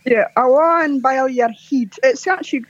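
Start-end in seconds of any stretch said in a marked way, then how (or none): 0.4-1.9 slow, broad Glasgow Awan bel your head; slow Glasgow dialect; Glaswegian phrase. Shown as a demonstration. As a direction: drawl it out like a Glaswegian insult. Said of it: "it's called a Paliamo Gleska".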